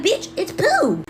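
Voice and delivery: slow flat matter-of-fact; deadpan. Retold or imitated. Little [name] tells sister, slow deadpan. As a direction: drag it out unbothered. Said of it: "And I was like".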